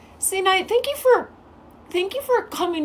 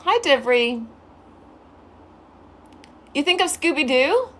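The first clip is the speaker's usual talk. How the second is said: normal talking voice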